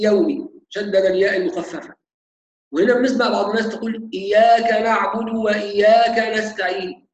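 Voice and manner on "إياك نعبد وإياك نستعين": إياك with the ya said light, not doubled; wrong light ya. Shown as a demonstration. Teacher demonstrating the wrong light ya in إياك. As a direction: read إياك with a single short ya, as the error. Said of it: "ياء مخففة".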